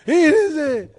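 loud, high acted cry; triumphant shout. Husband insists to wife he found it, triumphant shout. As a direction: shout it out, overjoyed.

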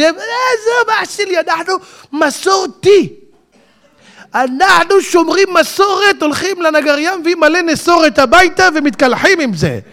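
high, put-on dismissive voice; mocking a secular guy. Preacher mocking a man who calls himself traditional, high mock voice. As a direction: mimic a casual guy brushing religion off.

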